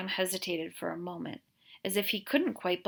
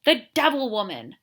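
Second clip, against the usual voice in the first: shout; 4 semitones higher, 7 dB louder than usual. A narrator's reading. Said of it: "Trout shouted".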